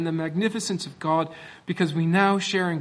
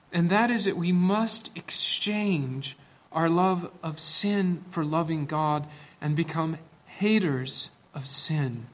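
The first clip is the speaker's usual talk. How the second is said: normal talking voice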